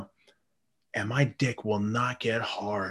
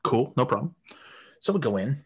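ordinary talk

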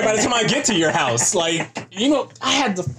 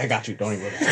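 reassuring; 8 semitones lower, 6 dB quieter, much faster than usual. Retold or imitated. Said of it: "I was like".

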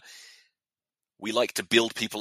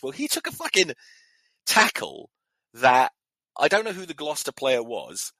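outraged; 6 semitones higher, 5 dB louder than usual. Real, not acted. Outraged at a bad tackle.

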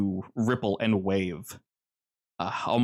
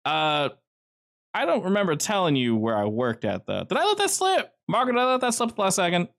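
Joe talking; 8 semitones higher than usual. Acted. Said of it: "And she goes".

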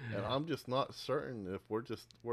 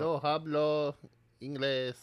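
higher, louder playful Spanish line; mock Spanish; 6 semitones higher, 4 dB louder, slower than usual. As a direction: dodge the question in jokey Spanish. Joking Spanish non-answer.

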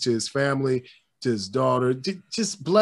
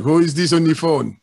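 mock gruff phone answer; 8 dB louder than usual. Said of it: "And be like".

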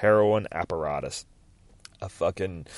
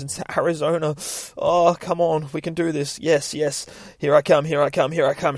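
high, keyed-up pep talk; excited self-talk; 6 semitones higher than usual. Retold or imitated. Junkie driving off hoping to get clean, excited self-talk. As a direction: talk yourself up in a raised, eager voice.

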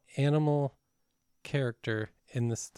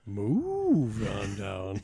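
very slow, stretched announcer call; drawn-out catchphrase; 3 semitones lower, much slower than usual. Pretend. A show catchphrase, drawn out slow. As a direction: drag every word out like a host.

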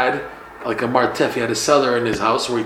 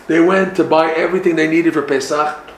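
usual speaking voice

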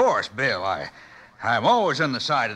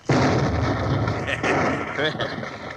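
gloating laugh. Acted. Villains gloat over the trap.

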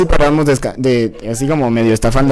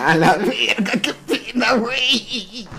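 higher, drawn-out words breaking into laughter; real laughing; 7 semitones higher, much slower than usual. Real, not acted. Host reacting to an embarrassing confession, laughing hard. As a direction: crack up while talking.

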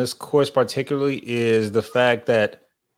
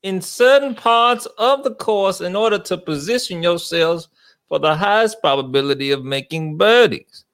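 put-on Southern accent; 3 semitones higher than usual. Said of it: "You do sound like you're about ready to fight in the Civil War".

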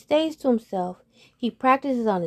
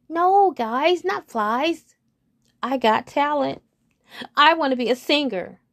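higher, louder earnest frog; eager frog; 6 semitones higher, 4 dB louder than usual. Acted. Frog telling his friends about his talent, higher. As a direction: insist proudly on the dream.

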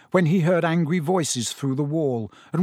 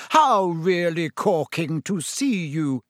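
slow, theatrical gushing; mock-pompous greeting; 3 semitones higher, slower than usual. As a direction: draw out the overblown politeness. Fred continues the pompous parody.

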